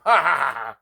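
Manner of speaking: mocking laugh. Kids laugh at their cousin stuck under a fallen chair, mockingly. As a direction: cackle at the trapped kid. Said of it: "we're all laughing at him".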